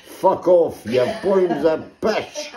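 loud jeering crowd yell; rowdy pub crowd shouting down the poet. Retold or imitated. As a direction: heckle him loudly from the bar. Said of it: "the crowds all shout".

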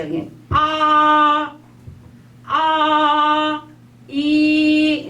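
아 and 이 stretched long and high; 아, 아, 이 drawn out slowly; slow a then i. Shown as a demonstration. Teacher modelling slow 아 then 이 for students. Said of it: "이제 천천히".

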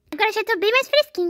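put-on high, delighted small boy; happy little boy voice. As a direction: gush like a thrilled kid. Voicing son doll.

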